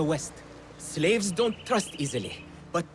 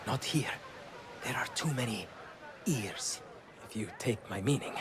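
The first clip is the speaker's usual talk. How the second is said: hushed; 6 semitones lower, 9 dB quieter than usual. Acted. Informant hushes to avoid ears.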